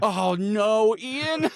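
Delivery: high, overdone dismay; hammed-up big-brother alarm; concerned older brother. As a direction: cry out in mock worry for a little brother. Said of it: "suddenly the most concerned older brother".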